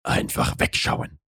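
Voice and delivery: low, hushed male inner voice; inner self-command. A narrator's reading. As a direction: whisper it to yourself as the man. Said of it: "sagte er sich". (leise geflüsterte innere Männerstimme)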